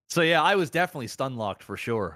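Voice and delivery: usual speaking voice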